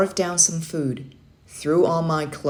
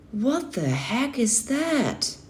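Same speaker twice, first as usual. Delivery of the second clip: baffled; 7 semitones higher, much slower than usual. Acted. Boy baffled by zero dark thirty.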